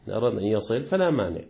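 normal talking voice

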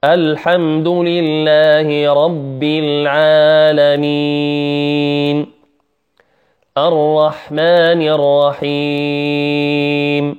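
slow chanted tajweed recitation; measured teaching recitation on a level tone; Quran recitation. Shown as a demonstration. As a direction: recite each verse slowly, drawing out the last vowel. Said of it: "نبدأ بسورة الفاتحة".

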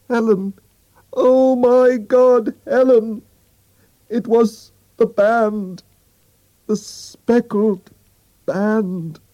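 high, loud, slow anguished cry; terrible cry. A narrator's reading. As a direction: scream it out in terror, dragging the words. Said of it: "she cried out in a terrible voice".

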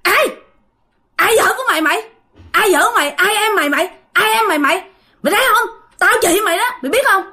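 high-pitched retold angry challenge; indignant snapping back. Possessed wife glaring at the husband who hugs her, indignant high-pitched rebuke. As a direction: act out a haughty, affronted rebuke in short bursts. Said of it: "bắt đầu liếc".

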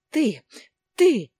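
high, very loud sputtering rage; furious shout. A narrator's reading. Lena sputtering at her husband in rage, furious shout. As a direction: spit the words out in fury. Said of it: "Лена прыскала слюной".

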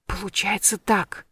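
soft, slow sighing reply. A narrator's reading. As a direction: let it out on a sigh. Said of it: "вздохнула я".

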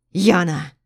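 loud wounded-beast roar; hurt husband roaring. Acted. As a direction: roar her name. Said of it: "Рыком зверя".

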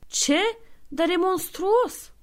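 slow, high horrified gasp of words; shocked. Acted. The thief horrified at Santa's methods, slow and high. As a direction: recoil at the cruelty.

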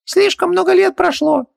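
very high squeaky little genie voice; squeaky genie servant, resigned; philosophical genie. A narrator's reading. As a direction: play a tiny high-pitched genie shrugging it off. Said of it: "философски развёл руками Хубаксис".

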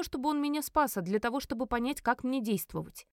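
ordinary delivery

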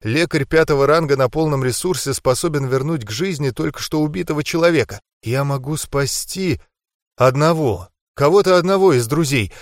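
plain reading voice

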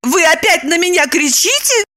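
high, indignant woman. A narrator's reading. Woman objects to being shouted at, high indignant voice. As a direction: protest it high and offended. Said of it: "повысила голос Липочка".

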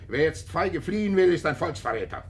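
rallying like a party speaker; bombastic proclamation. Acted. Kutzner lecturing the baron, loud and bombastic.

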